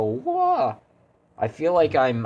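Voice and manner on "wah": mock whimper. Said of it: "I'm gonna go".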